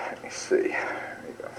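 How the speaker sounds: very soft, unvoiced aside to oneself; muttering to self. Real, not acted. Lecturer to self while finding the slide, muttered. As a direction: murmur it under your breath, almost whispered.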